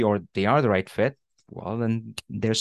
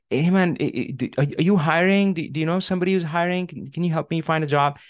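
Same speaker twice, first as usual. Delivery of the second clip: stammering, higher-pitched job plea; embarrassed asking; 5 semitones higher, faster than usual. Retold or imitated. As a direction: act out an awkward, fumbling request for work. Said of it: "I would be so embarrassed".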